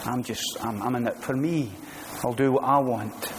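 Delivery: low, soft, can't-be-bothered mumble; indifferent shrug. Retold or imitated. Preacher mocking an uninterested churchgoer, low soft mumble. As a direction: mumble it like someone in it only for themselves. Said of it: "Or you can say".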